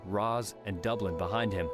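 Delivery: plain reading voice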